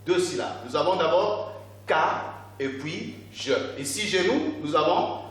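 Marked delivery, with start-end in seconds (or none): 1.9-3.5 "K" and "je" set apart as two syllables; syllables separated. Shown as a demonstration. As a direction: count out the syllables "K", then "je". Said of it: "combien de syllabes".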